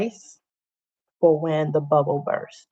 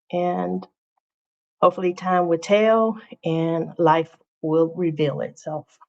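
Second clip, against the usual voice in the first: ordinary talk, nothing marked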